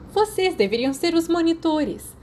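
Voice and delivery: high, loud scolding shout. A narrator's reading. Mary scolds the prefects by the fire, shouting. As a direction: yell it at the pair caught cuddling. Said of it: "Mary gritou com eles".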